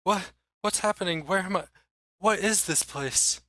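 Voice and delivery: high, halting, bewildered questions; frightened confusion. Acted. Mayor freed from a spell, asking where he is, frightened confusion. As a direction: stammer as if just waking somewhere strange.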